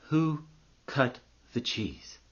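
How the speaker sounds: slow, hushed and weighty; monk puts his profound question to a student, solemn and slow. Acted. Monk at the meditating student's ear, solemn slow question.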